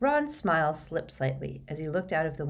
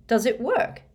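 higher, slightly louder eager question; hopeful; 7 semitones higher, 4 dB louder than usual. A narrator's reading. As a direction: ask with rising hope. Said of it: "inquired Ron hope".

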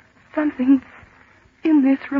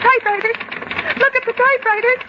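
high, fast, alarmed cry; startled scared shout; frightened outcry; 6 semitones higher, faster than usual. Acted. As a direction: point and shriek in fear. Sees typewriters typing alone.

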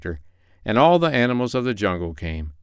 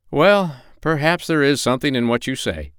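ordinary delivery

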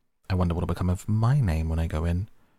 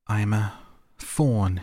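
higher and louder, bursting with joy; delighted cry; 4 semitones higher, 5 dB louder, slower than usual. A narrator's reading. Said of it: "it cried out, in a voice of delight".

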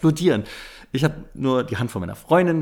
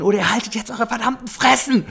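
retold yell; 9 semitones higher than usual. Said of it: "Hab die angeschrien".

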